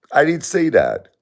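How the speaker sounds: I pushed high and loud; emphatic denial. Real, not acted. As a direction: insist you never said it. Repeating denial, much higher louder.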